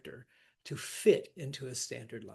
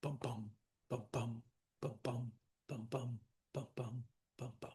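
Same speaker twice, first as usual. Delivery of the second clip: soft, paired bum-bum pulse; hushed, steady heartbeat in repeated pairs; imitated heartbeat; 3 semitones lower, 8 dB quieter than usual. Shown as a demonstration. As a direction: thump out a quiet heartbeat rhythm. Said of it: "and it's the heartbeat".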